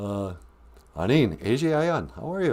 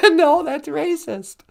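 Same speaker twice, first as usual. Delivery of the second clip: mock scolding voice; slower than usual. Answering in put-on voice.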